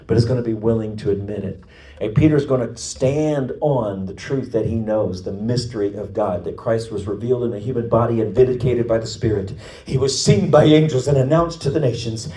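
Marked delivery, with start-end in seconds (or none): 2.0-12.3 impassioned preaching. Acted. Preacher proclaiming the mystery of God to the congregation, impassioned preaching. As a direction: preach it up to a ringing climax.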